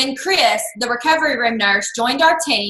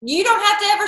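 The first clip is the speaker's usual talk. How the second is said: gleeful announcement; 7 semitones higher than usual. Real, not acted. Host revealing the favourite kind of prospect, gleeful. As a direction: draw the word out with big excitement.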